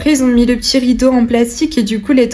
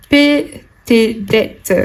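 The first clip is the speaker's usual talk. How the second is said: slow dramatic read; much slower than usual. Acted. Repeats card text dramatically.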